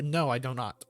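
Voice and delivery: normal talking voice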